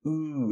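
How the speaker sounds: "U" as a long, held "oo" with a pout. Shown as a demonstration. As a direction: push the lips out and hold "U" as "oo". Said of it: "esse U com biquinho". ("U" longo, com biquinho)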